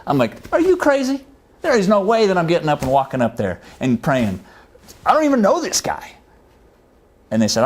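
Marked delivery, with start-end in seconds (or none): 0.5-6.1 incredulous protest. Retold or imitated. Arguing back with God.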